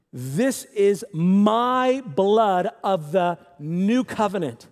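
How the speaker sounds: very slow, weighty scripture voice; solemn slow reading. Acted. Preacher reads Jesus' words to congregation, slow and reverent. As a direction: draw out each word reverently.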